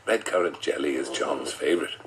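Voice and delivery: ordinary talk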